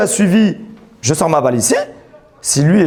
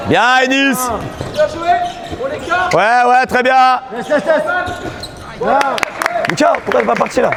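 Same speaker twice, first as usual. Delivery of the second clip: coach shouting; 8 semitones higher than usual. Real, not acted. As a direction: yell instructions across the court. Coach shouting during match.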